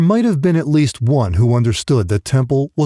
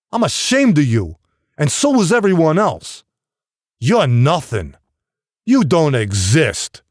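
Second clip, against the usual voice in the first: angry father; 5 semitones higher than usual. Acted. Father berating his son.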